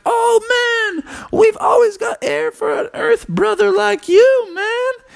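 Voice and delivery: high-pitched party frog voice; excited high frog character; moon froggy. Acted. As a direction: holler it like a welcoming frog buddy. Moon froggies welcome earth brother.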